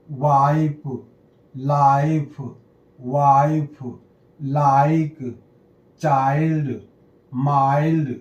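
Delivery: टाइम, पाइप, माइल्ड as slow single words, i said "eye"; long-i word list. Shown as a demonstration. Teacher showing how i becomes आई, drawn-out pronunciation demo. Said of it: "आई जैसे".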